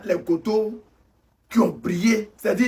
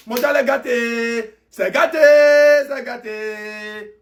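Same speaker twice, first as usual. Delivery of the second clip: slow wail, vowels stretched long; drawn-out lament; 3 semitones lower, slower than usual. Acted. Fan mourns that the World Cup is ruined, slow wailing. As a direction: moan it slowly, dragging out every ending.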